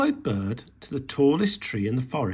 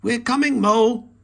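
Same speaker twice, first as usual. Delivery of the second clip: a louder, higher call; 10 semitones higher, 8 dB louder than usual. A narrator's reading. Rabbit to Mole from the balloon, loud call. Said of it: "called Rabbit".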